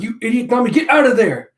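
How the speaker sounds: high, loud warning shout; urgent yell. Acted. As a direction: yell at her to flee. Usopp yells warning at Nami.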